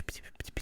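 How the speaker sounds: whispered blaster pew noises; whispered laser pews. Shown as a demonstration. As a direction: breathe the pews out without voice. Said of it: "Space shooters and pew".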